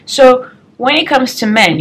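normal talking voice

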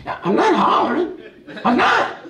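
high-pitched protest, rising on the repeat; hollering denial. Retold or imitated. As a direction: deny hollering while raising your voice. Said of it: "Why are you hollering so much?".

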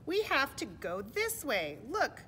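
high, loud, slow reply; insistent animal. Acted. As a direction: point the way with emphatic words. Animal answers the protest.

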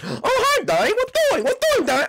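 a cheerful monster finding its prey; creepy animatronic greeting. Acted. Animatronic finding Y K, high singsong.

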